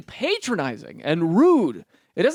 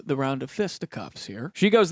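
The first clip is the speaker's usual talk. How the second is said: usual speaking voice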